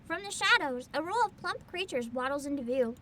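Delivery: ordinary talk, nothing marked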